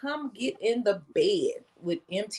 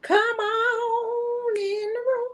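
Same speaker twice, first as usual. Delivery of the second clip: high, slow church lady croon; 13 semitones higher, much slower than usual. Acted. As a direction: sing them in like a church lady. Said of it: "that's my little church lady voice right there".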